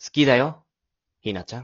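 confession. Acted. Boy confesses love.

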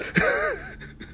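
bursting laugh. Real, not acted. Laughs at his own confession.

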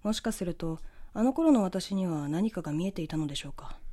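ordinary delivery

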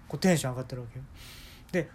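ordinary delivery

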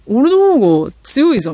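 a touch of a put-on manly voice; mild show-off voice of a drunk man; put-on nice voice. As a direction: lightly mimic a tough guy preening his voice. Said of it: "めっちゃいい声でさ".

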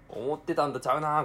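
disappointed surprise. Real, not acted.

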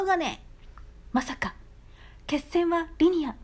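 hammed-up Nagoya dialect trailer voice; mock movie trailer in heavy Nagoya dialect; retold Nagoya-dialect movie ad. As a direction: boom it out like an over-the-top trailer in thick Nagoya dialect. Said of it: "っていう内容だったんですけどね".

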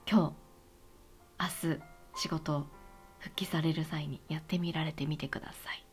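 low, soft whispered invitation; whispering. Real, not acted. Gentle closing encouragement.

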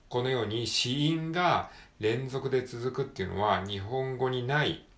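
ordinary talk, nothing marked

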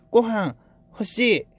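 put-on high, halting baby-talk cat voice; broken cute cat talk. Pet cat Dora begging for food, high halting voice. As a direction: squeak out the words like a clumsy cute cat. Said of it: "片言だったらまだ".

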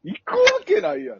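mock shouted refusal. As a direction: bark out the wife's no. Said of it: "って言ってたわ".

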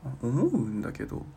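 normal talking voice

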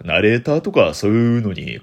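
normal talking voice

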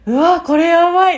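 high, loud うわ, これやばい; shocked exclamation. Retold or imitated. A woman recalling seeing her huge bruise with alarm. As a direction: react in alarm. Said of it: "ってなって".

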